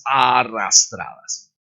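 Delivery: slow, drawn-out "arrastradas"; dragged out. Shown as a demonstration. Teacher illustrating English slurring to students, dragged out. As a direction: stretch the first vowel of "arrastradas". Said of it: "las palabras son mucho más arrastradas".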